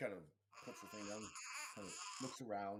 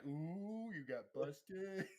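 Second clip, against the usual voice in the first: higher, slow teasing singsong; mock taunt, higher and drawn out; teasing ooh; 8 semitones higher, much slower than usual. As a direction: drag it out like a gleeful tease. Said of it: "Joe's like".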